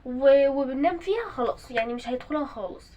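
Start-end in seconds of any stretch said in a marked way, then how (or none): none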